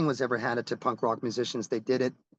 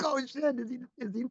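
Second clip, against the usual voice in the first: high-pitched retold panic; mock scared; 11 semitones higher than usual. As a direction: act out nervous second thoughts, pitched way up. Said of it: "I got kinda scared".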